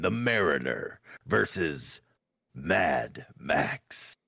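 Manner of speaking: bold heroic voice, dramatic and slow; movie hero voice. Acted. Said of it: "I love your fucking movie hero voice".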